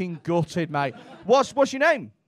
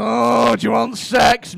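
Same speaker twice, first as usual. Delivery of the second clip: loud, hammed-up shout; belted-out caricature of a proposition; loud mock solicitation; 9 dB louder, slower than usual. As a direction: bellow it as a mock come-on. Imitates frightening sex worker soliciting.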